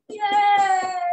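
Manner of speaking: high, loud cheer; cheering yay. Acted. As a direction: cheer for the parade. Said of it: "the warthogs clap".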